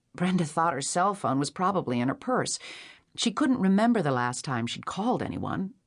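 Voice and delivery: plain reading voice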